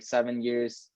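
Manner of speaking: ordinary talk, nothing marked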